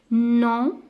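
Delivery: « non » lancé fort et haut; non appuyé. Shown as a demonstration. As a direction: marteler le « non » pour la classe. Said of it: "on va dire". (« non » crié, net et plus aigu)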